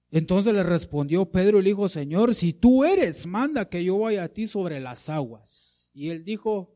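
loud, high, declaimed Bible reading; forceful scripture reading. A narrator's reading. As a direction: read Peter's request out boldly to the congregation.

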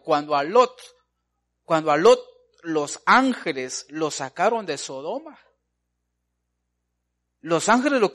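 loud, high-pitched preaching push; preacher at full force; fiery preaching. Acted. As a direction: drive the point home to the congregation.

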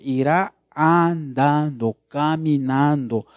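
"andando" and "llorando" stretched out slowly; slow, weighty scripture reading; slow, drawn-out reading. Shown as a demonstration. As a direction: draw the verse out as if walking and weeping. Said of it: "porque aquí dice".

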